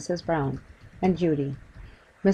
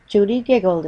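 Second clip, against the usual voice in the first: ordinary delivery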